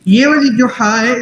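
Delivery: normal talking voice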